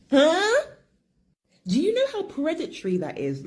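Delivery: high, loud shocked Huh; incredulous outrage; outraged disbelief. Real, not acted. Outraged at predatory man.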